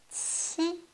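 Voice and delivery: « Qi » haut et léger; « Qi » à mi-voix, note haute; qi doux et haut. Shown as a demonstration. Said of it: "prononcez tout doucement". (qi doux)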